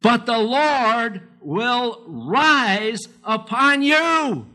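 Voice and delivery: high, slow, drawn-out preaching; slow scripture reading. Acted. Preacher slowly proclaiming Isaiah to the church, high. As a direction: stretch each word out like a proclamation.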